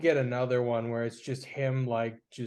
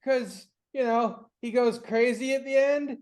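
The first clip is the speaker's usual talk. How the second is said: imitating Sean; 9 semitones higher than usual. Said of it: "And he said".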